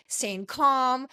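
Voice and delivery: usual speaking voice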